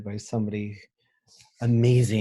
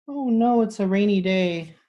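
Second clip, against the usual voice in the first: put-on high, loud oh no for the kids; mock dismay; 10 semitones higher, 6 dB louder than usual. Teacher showing the class a rainy page, mock dismay. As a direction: play up the disappointment for children. Reacts to picture of rain.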